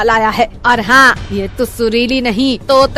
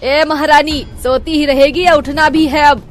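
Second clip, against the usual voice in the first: loud, higher wake-up call; 5 semitones higher, 5 dB louder than usual. Acted. Mother-in-law calls the sleeping bride loudly. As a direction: yell through the door to rouse her. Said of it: "Chitra आवाज लगाती है".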